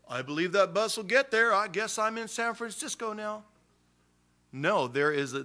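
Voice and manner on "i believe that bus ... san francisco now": high, mocking naive believer voice. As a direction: raise the pitch and ham up a naive believer. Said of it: "You can't just say".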